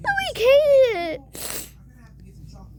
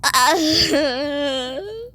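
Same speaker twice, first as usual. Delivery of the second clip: drawn-out mock wail; wordless fake sob, rising at the end; pretend crying. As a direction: bawl without words. Crying that gets hushed.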